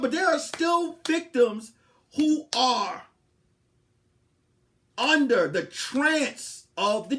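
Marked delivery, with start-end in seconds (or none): none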